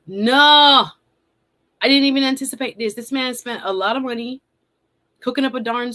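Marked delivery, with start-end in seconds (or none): 0.1-2.9 louder, fast protest; emphatic no. Real, not acted. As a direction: burst out with the no. Emphatic denial.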